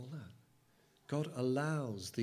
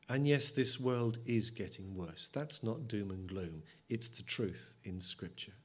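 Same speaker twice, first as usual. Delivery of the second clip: normal talking voice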